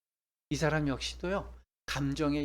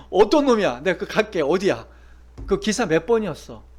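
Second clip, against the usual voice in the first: much louder, hot with rage; furious demand; 3 semitones higher, 11 dB louder than usual. Acted. Boyfriend demanding which driver wronged her, shouting angrily. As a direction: charge in ready to fight. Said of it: "이러면서".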